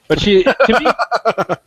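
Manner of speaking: laughing through the words. Real, not acted. Telling his co-host about his girlfriend, laughing. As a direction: break into a laugh mid-phrase.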